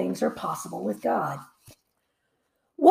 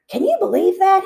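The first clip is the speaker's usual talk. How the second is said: higher and louder, a mild growl; 11 semitones higher, 6 dB louder than usual. A narrator's reading. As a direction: let it burst out in annoyance. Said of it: "he growled".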